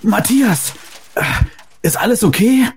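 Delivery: stammering in shock; high, shaken and stammering. Acted. Shocked after crash.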